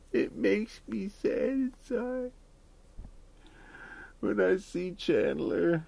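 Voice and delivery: high, very slow whiny put-on voice; hammed-up pitiful whine, drawn out; mock sad friend. Mocking friend's sad complaint.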